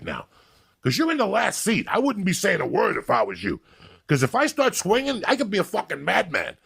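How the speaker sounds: high-pitched, worked-up retold tirade; acted-out threatening rant, pitch climbing; angry threat. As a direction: rant up high as if squaring up for a fight. Acts out threatening the German passenger.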